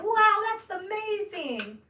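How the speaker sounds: high, loud, drawn-out amazed gush; mock-thrilled suburban gush over a shooting story; valley girl gush. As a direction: gush like a sheltered suburban girl hearing gossip. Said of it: "I'm talking like that".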